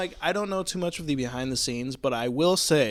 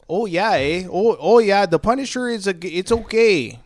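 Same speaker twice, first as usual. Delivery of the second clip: higher, louder hammed-up Canadian accent; 6 semitones higher, 8 dB louder than usual. Whiskey bottle reviewing the movie in Canadian accent. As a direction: sing it out like a Montreal local, eh. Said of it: "in a Canadian accent".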